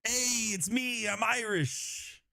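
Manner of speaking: high, very slow, hammed-up Irish accent; bad Irish accent. Commentator doing his best Irish accent on a dare, hammed-up. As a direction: ham up a cheesy Irish brogue, slowly. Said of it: "This is my best Irish accent".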